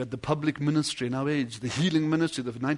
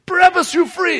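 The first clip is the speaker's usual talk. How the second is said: proclaiming freedom; 12 semitones higher, 11 dB louder than usual. Retold or imitated. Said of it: "He says".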